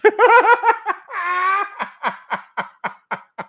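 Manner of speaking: loud high burst of laughter; real amused laugh. Real, not acted. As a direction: cackle at the joke. Laughs at the quote.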